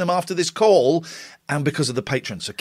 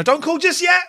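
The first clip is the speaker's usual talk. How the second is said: urgent call-out; 12 semitones higher than usual. Real, not acted. Host stopping callers urgently.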